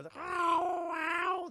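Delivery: long, high-pitched howl; drawn-out high mock siren howl; imitated wailing noise. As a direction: wail like a noise from speakers. Imitates noise over stadium speakers.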